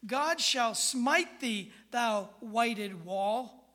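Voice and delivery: booming, slow prophetic rebuke; mock biblical prophet. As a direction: thunder it out like an old prophet, slowly. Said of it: "watch this".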